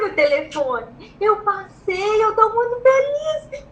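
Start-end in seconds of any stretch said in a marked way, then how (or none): 1.2-3.6 high, slow, rising wail of happiness; acted happy cry on the phone; re-enacted tearful joy. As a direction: stretch it out, overcome with joy. Retold joyful phone call to father.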